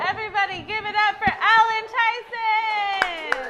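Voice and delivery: loud, high announcer cheer; hyped introduction. Real, not acted. Host brings Alan Tyson on stage, rousing shout. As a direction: bring the act on, stretching the name.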